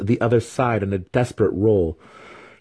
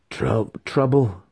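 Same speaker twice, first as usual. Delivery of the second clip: ordinary delivery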